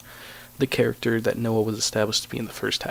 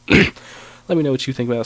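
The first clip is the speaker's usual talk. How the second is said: sick, strained throat; 8 dB louder than usual. Real, not acted. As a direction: push words through a sore throat. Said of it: "Sorry if my voice is strained there".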